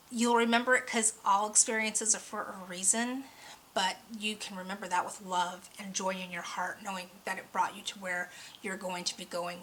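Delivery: usual speaking voice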